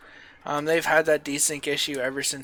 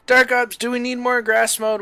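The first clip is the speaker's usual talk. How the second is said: calling out; 6 semitones higher, 5 dB louder than usual. Real, not acted. Calling out to teammate.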